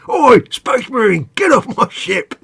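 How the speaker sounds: high, slow, loud orc roar; orc warboss. Retold or imitated. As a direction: bellow it like the warboss greeting invaders. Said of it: "and he goes".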